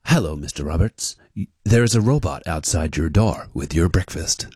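low, flat automated phone voice; robot voice. Retold or imitated. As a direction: read it like a recorded phone message. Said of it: "And it goes".

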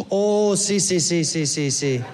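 imitated Costa Rican "sí, sí"; lightly accented Costa Rican guy; Costa Rican local. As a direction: rattle off agreement in a Costa Rican accent, falling away. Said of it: "He goes".

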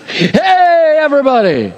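high calling out to everyone; mock shout. Joking about God announcing to everyone, mock high shout. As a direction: call out like through a megaphone.

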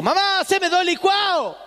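high, slow call across the house; a kid calling out to his mother in the laundry; yelling for mom. Retold or imitated. Kid calling mom from the kitchen, high and drawn out.

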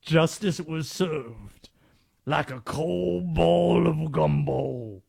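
slow, drawn-out Southern cartoon lawyer; Louisiana attorney impression. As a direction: stretch it out like Foghorn Leghorn. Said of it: "An attorney saying".

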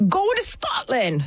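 put-on a slow, feeble Scottish accent; unconvincing Scottish accent; bad Scottish accent. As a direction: try a Scottish accent and miss. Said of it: "Is that a Scottish accent?".

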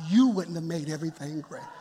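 dry agreement with a laugh in it; laughing comeback. Real, not acted. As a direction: turn the complaint back with a chuckle. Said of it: "You're right".

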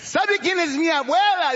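put-on young grandson. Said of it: "Yo soy nieto de la".

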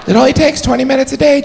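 fitness ad voice. Retold or imitated. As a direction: sell it like a workout ad. Mocking a fitness ad pitch.

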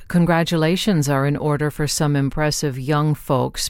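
normal talking voice

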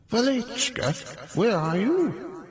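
high, slow drawn-out call; Stalin summoning his housekeeper from his office. A narrator's reading. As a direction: call out lazily across the hall. Stalin calling for her.

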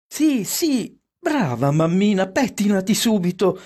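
put-on high-pitched, delighted children; eager high-pitched urging; children's eager approval. A narrator's reading. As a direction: cheer mamma on in high child voices. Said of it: "approvarono i figlioli".

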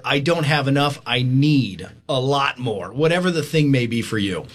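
ordinary delivery

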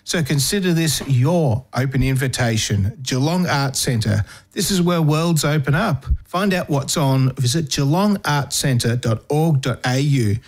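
ordinary delivery

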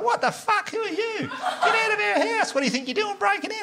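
very high mock woman's yelling; outraged lady. Woman next door to intruders breaking in, mock outrage. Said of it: "the lady was like".

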